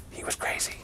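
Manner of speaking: unvoiced whisper; hushed whispered aside. A narrator's reading. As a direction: whisper it like a secret.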